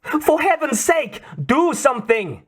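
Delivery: put-on high desperate Russian-accented plea; pleading. A narrator's reading. Trapped woman begging Bond, high frantic Russian-accented plea. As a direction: beg him to act, frantic. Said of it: "the woman was pleading".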